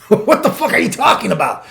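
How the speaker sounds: laughing through a mock outraged question; half laughing at the absurdity; indignant question cracking into laughter; laughing disbelief. Retold outrage while laughing.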